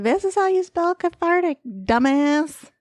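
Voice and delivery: high, mocking know-it-all; snarky listener voice. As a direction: sneer like a smug commenter. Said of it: "be like".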